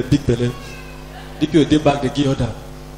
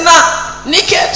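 robber's order; 10 semitones higher than usual. Retold or imitated. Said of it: "They say".

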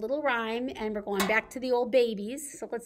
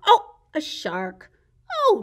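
ordinary talk, nothing marked

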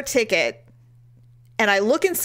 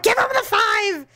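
much higher insistent "Give him the five"; mock urgent instruction; 12 semitones higher, 3 dB louder than usual. Telling her friend to tip the driver, mock urgent. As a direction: push your friend to tip now. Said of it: "I'm like".

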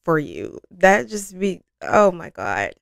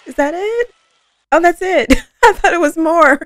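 high, loud, laughing surprise; surprised laughing disbelief; 11 semitones higher, 6 dB louder than usual. Real, not acted. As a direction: laugh that it's already over. Surprised the song ended.

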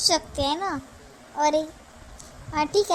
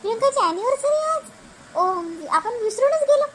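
sudden realization; 7 semitones higher than usual. Acted. Wife suddenly remembers anniversary.

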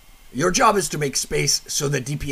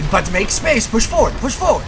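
urgent coaching shout; 8 semitones higher, 6 dB louder than usual. Acted. Coach urging the player forward.

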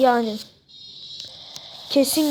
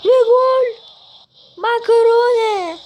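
goal celebration shout; 9 semitones higher, 5 dB louder, slower than usual. Real, not acted. Goal scored in football game.

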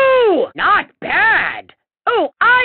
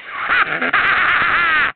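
evil cackle. Acted. Villain gloats.

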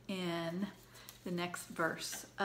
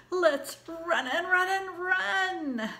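excited; 8 semitones higher, 6 dB louder, slower than usual. Acted. Excited pumpkin voiced high.

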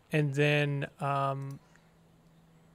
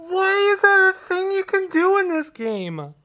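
despairing shout; 14 semitones higher, 3 dB louder than usual. Real, not acted. Still reacting to loss.

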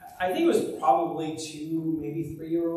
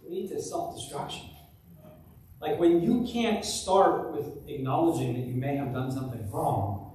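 ordinary talk, nothing marked

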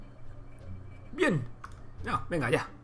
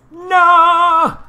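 sudden high shriek of panic; panicked scream; 16 dB louder than usual. Real, not acted. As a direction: scream no in alarm. Panic in game.

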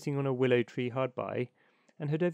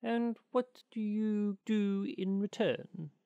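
higher, slow, probing little bird; little linnet; 6 semitones higher, slower than usual. Acted. Linnet questioning the water rat, higher slow curious voice. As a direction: ask it slowly and high like a small curious bird. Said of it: "said the little bird".

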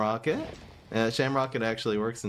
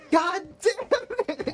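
high, slow, drawn-out curse yelled at the screen; frustrated shout; 3 dB louder, much slower than usual. Real, not acted. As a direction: let it rip in real frustration. Opponent kills his card.

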